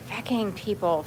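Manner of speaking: ordinary talk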